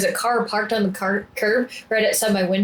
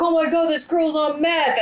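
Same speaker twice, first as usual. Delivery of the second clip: high-pitched mock alarm; imitated yelling; 8 semitones higher than usual. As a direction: imitate onlookers yelling about someone strange. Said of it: "they started yelling".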